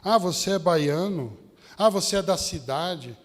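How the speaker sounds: normal talking voice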